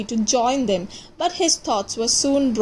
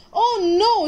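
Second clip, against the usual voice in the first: high alarmed cry; alarmed shout; 9 semitones higher than usual. Acted. Park keeper seeing the bird fall, high alarmed cry. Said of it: "shouted Percy".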